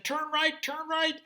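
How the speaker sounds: high little man voice; urgent high directions; little fairy. Acted.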